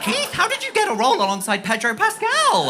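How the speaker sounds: high, loud, laughing disbelief; incredulous shriek. Acted. To Keith, a high, loud shocked laughing squeal.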